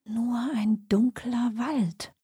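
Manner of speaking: slow, near-whispered murmur; soft murmur. A narrator's reading. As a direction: whisper it to yourself, drawing the words out. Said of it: "murmelte sie leise".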